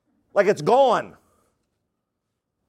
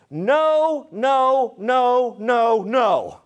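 NO repeated higher, each one drawn out and separate; emphatic string of NO, high at first; emphatic refusal; 4 semitones higher, much slower than usual. Shown as a demonstration. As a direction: hammer out every NO, starting high and stepping down. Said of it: "Like, there's emphasis here".

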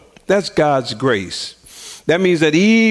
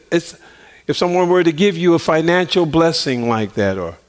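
ordinary talk

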